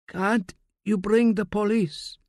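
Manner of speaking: high, slow pleading frail voice; a weak, dying old man begging. A narrator's reading.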